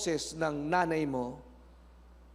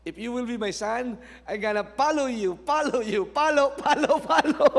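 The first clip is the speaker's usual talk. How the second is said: higher, louder chant of the son; playful child threat; 6 semitones higher, 5 dB louder than usual. Retold or imitated. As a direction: chase him chanting, getting higher and louder. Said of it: "Kumuha siya ng tsinelas".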